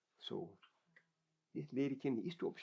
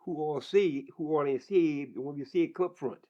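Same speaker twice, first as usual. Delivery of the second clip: preacher's call; 3 semitones higher, 9 dB louder than usual. Retold or imitated. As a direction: call the congregation up front like a pastor. Pastor calling congregation forward.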